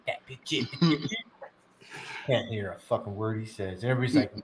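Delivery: ordinary talk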